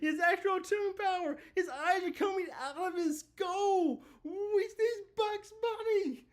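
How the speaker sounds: thrilled fan cracking up; hyped laughing scream. Real, not acted. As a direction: shriek with delight, slowing on Bugs Bunny. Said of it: "'cause that was hyped for this chapter".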